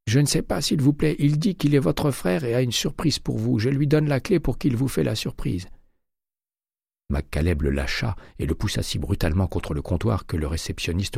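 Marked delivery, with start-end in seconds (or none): 0.1-5.7 high, choked pleading voice; frightened clerk. A narrator's reading. Terrified clerk under McCaleb's arm, high and pleading. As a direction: beg to be let go.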